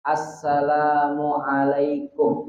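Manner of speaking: Assalamualaikum drawn out slowly while facing front; slow facing-forward demo. Shown as a demonstration. Teacher models the first salam facing qibla. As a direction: hold the greeting slow and steady. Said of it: "Mimnya kum baru boleh".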